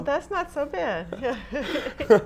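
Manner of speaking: said through laughter; laughing. Real, not acted. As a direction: laugh while answering. Laughing at his accent.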